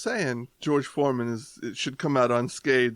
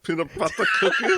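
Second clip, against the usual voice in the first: mock German accent, rising question; 5 semitones higher than usual. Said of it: "I said it in a German accent".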